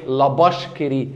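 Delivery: "لا باش كري" with b for v; Egyptian mispronunciation. Shown as a demonstration. Teacher mimics how locals say the brand, Egyptian mispronunciation. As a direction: say it slowly the Egyptian way, bash and kiri run together. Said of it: "لما نيجي ننطقها بنقول ايه".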